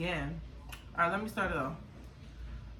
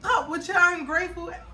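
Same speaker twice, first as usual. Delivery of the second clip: high, loud fed-up mother; mock scolding; 9 semitones higher, 4 dB louder than usual. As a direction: imitate mom telling off her whiny daughter. Retold mom scolding her again.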